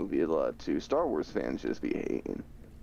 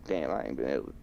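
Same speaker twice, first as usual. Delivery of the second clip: ordinary talk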